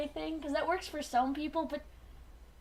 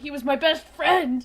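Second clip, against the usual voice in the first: loud, slow wail; mock grief cry; 3 semitones higher, 8 dB louder than usual. Quoting Rhinox mourning Rat Trap, slow mock wail. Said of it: "He's like".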